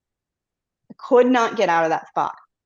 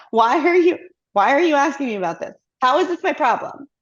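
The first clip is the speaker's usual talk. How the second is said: irritated retort; 5 semitones higher than usual. Retold or imitated. Worker brushing off colleagues' urgent requests, exasperated and higher. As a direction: push the problem back at them, exasperated.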